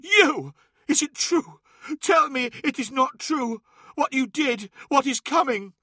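very high cry, pleading in disbelief; anguished, betrayed. A narrator's reading. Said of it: "crushed by the gut-wrenching hurt of betrayal".

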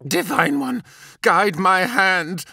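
high, slow, fervent prayer. A narrator's reading. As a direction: pray it slowly with devotion. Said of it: "spoke a prayer aloud".

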